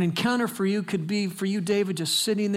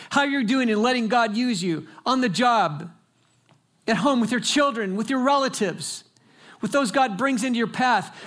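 higher and louder, rising exhortation; fervent preaching; 8 semitones higher, 4 dB louder than usual. Acted. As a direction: preach it to the congregation with urgency. Preaching builds to full force.

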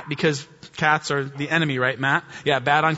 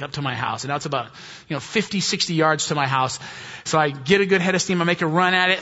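usual speaking voice

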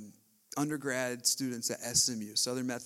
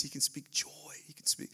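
hushed whisper; soft breathy hush; 5 dB quieter than usual. Real, not acted. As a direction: drop to a whisper for effect. Sermon quiets to whisper.

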